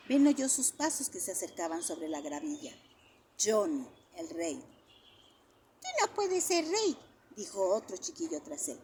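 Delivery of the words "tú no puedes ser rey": put-on objecting child. A narrator's reading.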